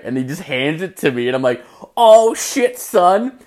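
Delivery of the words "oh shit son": high, loud whoop of delight; retold gleeful shock. Grabbing someone else's prepaid McDonald's order, a high delighted whoop. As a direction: crow it like you just scored free food. Gets free food, delighted.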